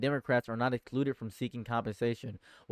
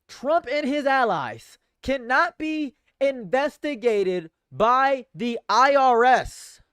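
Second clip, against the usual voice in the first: much higher, louder and slow, each word hammered; stunned outrage; 11 semitones higher, 8 dB louder, slower than usual. Real, not acted. Streamer rereads the news to chat in loud disbelief. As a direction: repeat it in disbelief, shouting it out. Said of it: "are we all reading the same thing".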